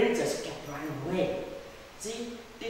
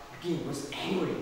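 usual speaking voice

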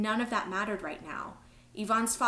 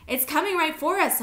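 high, loud cry of alarm; narrated scream, raised and urgent; panicked scream; 8 semitones higher, 8 dB louder than usual. A narrator's reading. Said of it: "Amara screamed".

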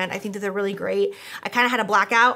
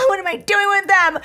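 high, loud, whiny wail of disbelief; hammed-up despairing cry; exasperated mock cry; 11 semitones higher, 6 dB louder than usual. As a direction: wail it like you're at a total loss. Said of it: "I'm like".

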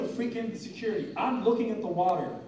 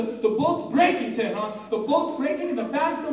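normal talking voice

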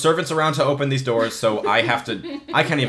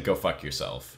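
low, dry brush-off; a hint of a polite local put-down; lightly voiced deadpan insult; Yolkin way of saying it; 11 semitones lower, 9 dB quieter than usual. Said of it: "the Yolkin way of saying".

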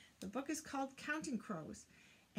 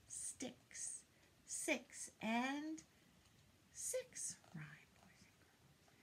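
hushed whispered aside; 3 semitones higher, 5 dB quieter, much slower than usual. Acted. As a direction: whisper the rhyme to the kids. Whispered rhyme aside to kids.

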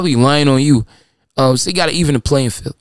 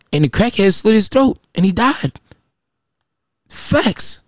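ordinary delivery

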